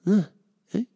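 ordinary talk, nothing marked

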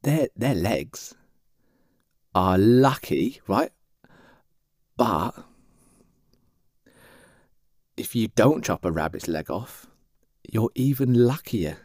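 slow reveal with long suspenseful pauses; mock-profound, halting announcement; Sandy's grave pronouncement. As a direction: deliver it piece by piece, very deliberately. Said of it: "Sandy leans forward and goes".

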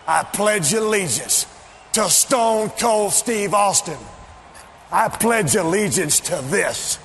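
slow, deliberate, every word weighed out; slow solemn pledge. Acted. Taunting his rival with a slow, solemn pledge. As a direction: recite it slowly like a solemn oath.

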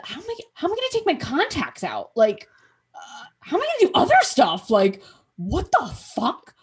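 high, rising panic; mock panic over how to take out contacts; panicked rant. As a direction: spiral into frantic questions. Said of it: "I was like".

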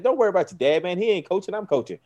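pumped-up encouragement to a little player. Retold or imitated. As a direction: sell the kid on defense with high energy. Hyping up kid on field.

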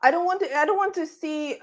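ordinary talk, nothing marked

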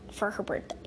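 whisper. Acted. Plotting a secret birthday gift.

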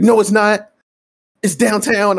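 high, loud imitation of an insistent man; mock stubborn contradiction. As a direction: argue back loudly, mimicking his certainty.

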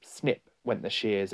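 usual speaking voice

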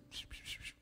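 soft unvoiced psh psh; shushing. Pastor shushing the complaining hiker softly. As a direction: hush someone gently. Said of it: "eu falei assim".

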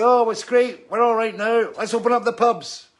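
high, loud sneering mimicry of careless people; mock them, high and loud; jeering caricature of pub fans. Mocking pub-reopening crowd again.

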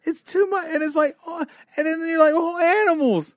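high, loud, choked-up voice, half laughing; tearing up. Real, not acted. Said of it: "I'm tearing up right now".

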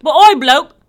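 loud mock British tough talk; South London accent. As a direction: swagger like a South London bloke. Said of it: "I'm from South London".

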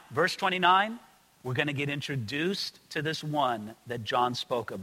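ordinary delivery

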